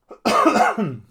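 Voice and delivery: a loud sudden cough; coughing fit. Real, not acted. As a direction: cough hard, mid-sentence. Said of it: "No paro de toser".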